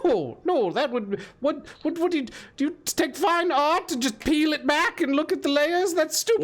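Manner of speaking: indignant hag, high and lilting; hag character. Acted. Hag rejects dissecting the treasure, indignant hag voice. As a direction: scoff in the hag's high voice.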